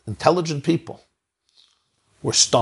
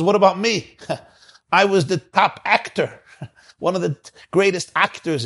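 laughing actor boasting; 8 semitones higher than usual. Retold or imitated. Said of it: "the next one says".

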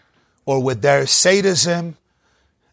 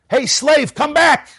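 high, louder taunting call; inner oppressor's voice; 12 semitones higher, 5 dB louder, slower than usual. Acted. Inner voice of Mitzrayim to the runaway slave, taunting call. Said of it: "There was an inner voice of Mitzrayim".

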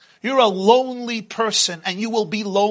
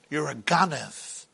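low accusing; 6 semitones lower, 8 dB quieter than usual. Acted. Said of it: "and somebody says".

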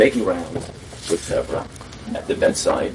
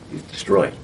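normal talking voice

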